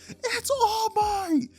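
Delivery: very high, drawn-out fanboy shriek; freaking out. Acted. Izuku spots his hero All Might, freaking out. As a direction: squeal it loud and long. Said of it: "starts immediately freaking out".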